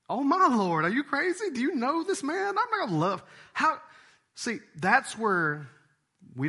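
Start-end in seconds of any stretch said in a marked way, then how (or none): 0.1-3.8 high, loud incredulous protest; mock outrage. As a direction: scoff in disbelief. Listener balking at the command.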